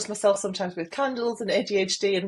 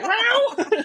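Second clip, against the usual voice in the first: high laughing shriek of delight; excited scream; 5 dB louder than usual. Pretend. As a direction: shriek it with glee.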